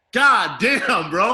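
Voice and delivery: loud exclamation. Retold or imitated. Friend reacts to the smell.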